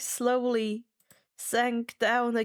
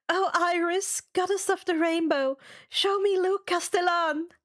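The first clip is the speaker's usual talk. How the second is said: insistent plea; 7 semitones higher than usual. Acted. Third try after gritting teeth.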